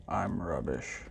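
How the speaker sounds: deep, quiet, forlorn robot; sad robot. A narrator's reading. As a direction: speak low and slow like a lonely old machine. Robot speaks in the story.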